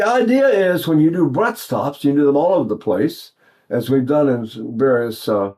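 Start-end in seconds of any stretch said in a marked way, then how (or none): none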